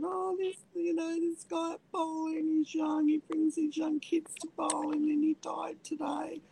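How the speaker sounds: high, hushed, half-whispered grief; tearful whisper. Retold or imitated. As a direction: breathe it out, close to tears. Telling husband friend died.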